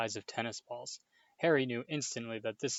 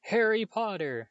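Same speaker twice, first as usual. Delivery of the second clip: slow, much higher squeaky greeting; high-pitched voice; 10 semitones higher, 6 dB louder than usual. A narrator's reading. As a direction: squeak the name out slowly. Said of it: "in a high-pitched voice".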